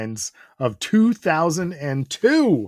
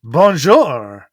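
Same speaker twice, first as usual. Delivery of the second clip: bad French accent; 8 dB louder than usual. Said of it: "these bad attempts at French accents".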